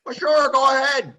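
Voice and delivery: lightly voiced old grandfather; grandpa's voice. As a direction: give him a stubborn old man's answer. Grandpa answers, voiced in story.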